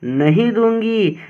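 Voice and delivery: ordinary talk, nothing marked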